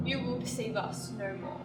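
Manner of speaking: sudden drop to a low, soft verdict; quiet finality. Acted. Sister ending her tirade at the deceiver, quietly final. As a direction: pull right down after the outburst.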